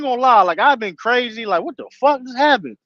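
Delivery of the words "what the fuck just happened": retold angry outburst. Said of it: "I've been mad as shit though".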